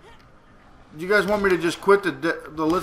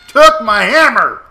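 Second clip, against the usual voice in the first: angry; 6 semitones higher, 12 dB louder, slower than usual. Real, not acted. Outraged at enemy.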